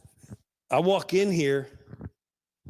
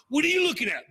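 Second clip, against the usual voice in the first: higher barroom taunt; hammed-up bar challenge; mock tough-guy challenge; 9 semitones higher, much faster than usual. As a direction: pick a fight with each patron.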